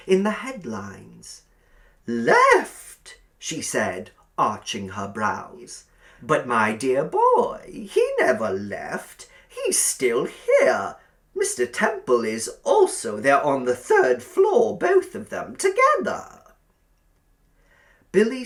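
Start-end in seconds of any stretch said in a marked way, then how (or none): 2.1-3.2 high, loud, cloying landlady voice; sugary old landlady. A narrator's reading. Landlady inviting Billy to sit by the fire, cloying. 6.2-16.4 high, loud, cloying landlady voice; sugary old landlady. A narrator's reading. Landlady inviting Billy to sit by the fire, cloying.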